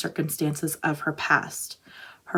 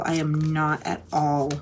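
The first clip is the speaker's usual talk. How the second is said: usual speaking voice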